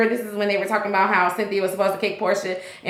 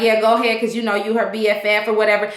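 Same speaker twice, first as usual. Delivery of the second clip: ordinary talk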